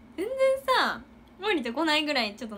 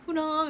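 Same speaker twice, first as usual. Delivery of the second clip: mock wobbly faint; 6 semitones higher, 5 dB louder, slower than usual. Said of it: "みたいななってて".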